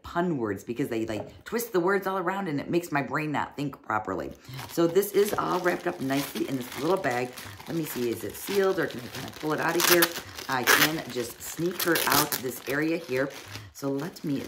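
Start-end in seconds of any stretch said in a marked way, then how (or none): none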